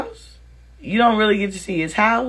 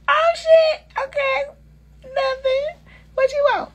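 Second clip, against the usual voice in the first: very high squeaky mock-coy voice; smitten squeal. Woman answering a flirting Louisiana man, high squeaky swoon. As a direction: squeal it like you've been charmed.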